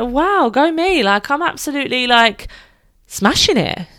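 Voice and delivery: high, bright-eyed cheer for yourself; excited self-praise. Pretend. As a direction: pump yourself up like you're proud of a win. Self-praise she tells listeners to say.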